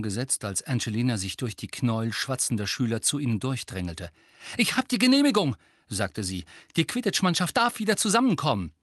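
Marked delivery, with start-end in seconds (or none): 4.6-5.6 high, loud triumphant announcement; beaming, raised excited voice; jubilant. A narrator's reading. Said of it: "strahlte Angelina". 6.8-8.7 high, fast excited news; beaming and breathlessly eager; jubilant. A narrator's reading. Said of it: "strahlte Angelina".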